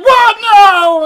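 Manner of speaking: Annie's anguished shout. Retold or imitated. Imitating Annie as Paul burns the book, anguished shout. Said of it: "She's like".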